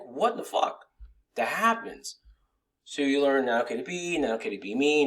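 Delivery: normal talking voice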